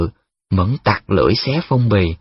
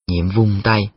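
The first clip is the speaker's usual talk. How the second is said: ordinary delivery, nothing marked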